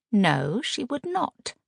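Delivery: icy, final refusal; cold. Acted. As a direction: shut her down flatly. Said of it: "Agatha said coldly".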